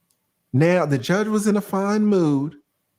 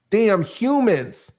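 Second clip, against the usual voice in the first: slow and higher, dragging out damn humans; drawn-out scorn; 4 semitones higher, much slower than usual. Acted. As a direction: sneer the words out slowly. Scorning people in general.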